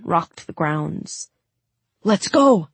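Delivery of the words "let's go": raised, louder call to move; urgent shout. A narrator's reading. Mal to companions as an explosion rocks the grounds, urgent shout. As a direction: shout it to get everyone running. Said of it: "shouted Mal".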